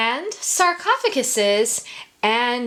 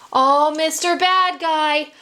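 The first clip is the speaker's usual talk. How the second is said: pleading cry; 8 semitones higher, 4 dB louder, slower than usual. A narrator's reading. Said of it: "cried Bill".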